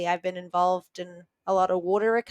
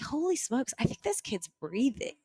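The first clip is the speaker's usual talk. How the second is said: higher, softer, hushed astonishment; stunned disbelief; 3 semitones higher, 7 dB quieter than usual. Retold or imitated. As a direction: murmur it as if you can't believe it. Sees the dead child breathe.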